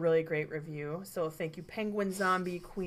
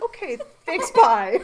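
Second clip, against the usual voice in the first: hasty awkward goodbye; 6 semitones higher, 11 dB louder, slower than usual. Retold or imitated. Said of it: "I was like".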